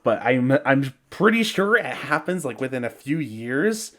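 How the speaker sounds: usual speaking voice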